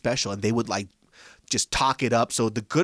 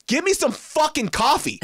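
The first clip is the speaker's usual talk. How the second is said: much higher, snapping back in a mock outburst; angry retort; 13 semitones higher than usual. Customer snapping at the rude barista, mock anger. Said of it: "And so I got so mad".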